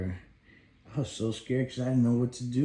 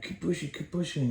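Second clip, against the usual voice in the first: higher, urging keep pushing; dad coaxing baby, higher; eager encouragement; 8 semitones higher than usual. Retold or imitated. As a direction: egg her on brightly.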